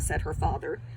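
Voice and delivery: plain reading voice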